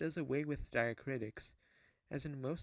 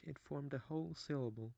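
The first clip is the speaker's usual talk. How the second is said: ordinary delivery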